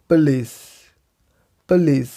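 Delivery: पुलिस as "puh-LEES", first vowel reduced; correct pronunciation. Shown as a demonstration. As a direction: say पुलिस with a quick "puh", falling at the end. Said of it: "हम बोलेंगे". (पुलिस को 'पलीस' जैसा बोलें)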